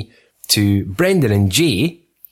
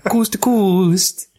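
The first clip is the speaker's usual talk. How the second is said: high, slow put-on American; badly faked American, pitched high; mock American accent; 9 semitones higher, slower than usual. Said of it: "so it's like that, but it's proper American".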